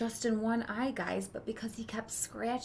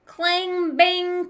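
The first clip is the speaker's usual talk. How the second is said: higher, louder clang bang; clanging metal noises; 8 semitones higher, 12 dB louder than usual. Acted. Boy imitating noises from Papa's shop, ringing clangs. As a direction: ring them out like a hammer on metal.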